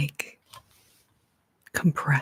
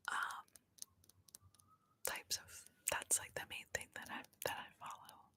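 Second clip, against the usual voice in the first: barely audible ASMR whisper; very quiet whisper; 19 dB quieter than usual. Real, not acted. ASMR host tells viewers about fake accounts, faint whisper. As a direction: whisper so softly it nearly vanishes.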